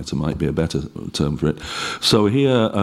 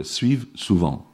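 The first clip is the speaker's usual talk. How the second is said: suivent souvent said slowly the French way; slow careful French; French accent. Shown as a demonstration. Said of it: "note the order here".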